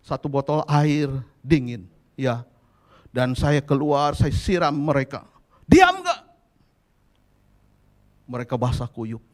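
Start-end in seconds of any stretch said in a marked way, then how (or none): 5.7-6.2 high, explosive yelled threat; angry shout. Retold or imitated. Father drenching his fighting children with water, angry shout. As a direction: yell it as an angry parent's order.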